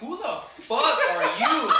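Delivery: loud, high, slow outraged yell; heated. Retold or imitated. Her son confronting strangers running from the house, heated. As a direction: imitate an angry son yelling at strangers. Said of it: "He's fucking heated".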